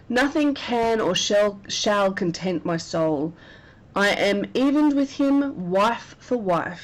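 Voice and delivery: slow, intense reading of Iago's vow; dramatic verse reading. Acted. Teacher voicing Iago's vow of revenge, slow and intense. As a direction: let the revenge line land slowly and heavily. Reads Iago's soliloquy aloud.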